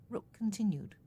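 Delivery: ordinary delivery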